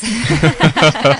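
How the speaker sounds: bright burst of laughter; loud laugh. Real, not acted. Laughs at own joke.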